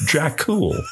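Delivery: exaggerated accent on Dracula; mock film accent. Repeats the joke accent on Dracula.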